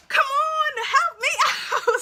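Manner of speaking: high, loud, drawn-out cry for help with a laugh; acted exasperated plea. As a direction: shout it pleadingly, half laughing. Pleading with mom to step in.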